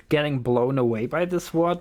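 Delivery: normal talking voice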